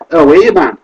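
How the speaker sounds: tossed-off Geordie accent greeting, hammed up. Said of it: "not gonna do all these accents".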